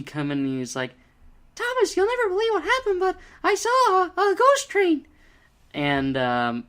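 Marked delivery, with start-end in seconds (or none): 1.5-5.1 very high, fast squeaky cartoon mouse voice; Mickey Mouse voice. Reviewer imitating Percy as Mickey Mouse, very high and fast. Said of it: "Percy sounded like Mickey Mouse".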